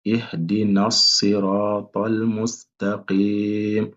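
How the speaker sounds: ihdinas siratal mustaqim, très lent et lié; répéter lentement pour les élèves; récitation lente. Shown as a demonstration. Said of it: "Je répète encore". (récitation lente et liée)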